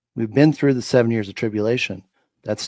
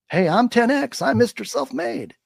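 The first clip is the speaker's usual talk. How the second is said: high-pitched mocking braggart; mock self-congratulation in a raised voice; mock boasting; 11 semitones higher than usual. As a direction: imitate a puffed-up self-promoter, pitched way up. Mocking self-made people's boasts.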